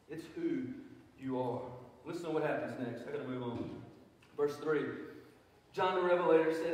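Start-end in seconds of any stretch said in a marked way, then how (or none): 0.1-1.6 P=A; stress on who and you. Shown as a demonstration.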